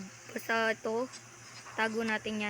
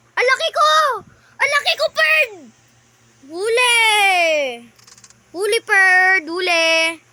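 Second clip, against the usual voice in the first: excited shouting; 7 dB louder, slower than usual. Real, not acted. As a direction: shout caught you at full voice. Yelling excitedly while catching.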